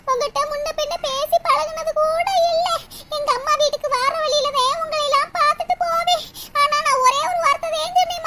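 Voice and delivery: high-pitched tearful lament, climbing as it goes; crying. Acted. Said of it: "மூசு மூசு மூசுன்னு அழுதுகிட்டே இருக்கா".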